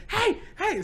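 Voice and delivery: high mock girl's yell; little sister yelling. Cynthia yelling at the fighting boys, high mock call. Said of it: "Cynthia goes out there".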